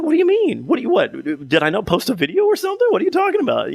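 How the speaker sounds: a bewildered pitch-up asking what he means; retold shock at a friend's accusation; baffled surprise. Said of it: "I'm like".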